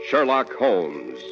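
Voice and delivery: usual speaking voice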